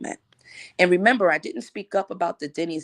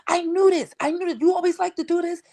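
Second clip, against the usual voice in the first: mocking friend; 14 semitones higher than usual. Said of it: "And she was like".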